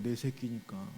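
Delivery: a tender, hushed afterthought; soft echo. Real, not acted. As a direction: let 내 새끼니까 fall away tenderly, almost to yourself. Soft echo of previous line.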